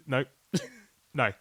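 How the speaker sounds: normal talking voice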